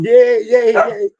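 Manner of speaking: high, loud, gleeful yay; excited cheering. Retold or imitated. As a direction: cheer it out like a thrilled kid. Friend jumps up and down.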